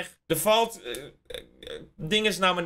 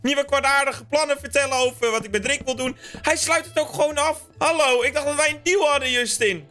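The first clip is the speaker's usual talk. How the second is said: mock outrage; 9 semitones higher than usual.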